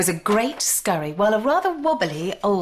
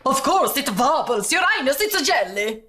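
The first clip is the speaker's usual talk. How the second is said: flustered; 6 semitones higher than usual. A narrator's reading. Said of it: "flustered the cook".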